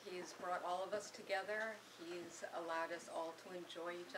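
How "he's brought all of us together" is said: ordinary talk, nothing marked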